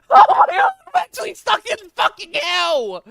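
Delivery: very high, loud outburst breaking into laughter; frantic shouting. Real, not acted. Streamer realizing he is trapped in game hell, frantic yelling. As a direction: shriek it in disbelieving exasperation. Discovers he is stuck in Nether.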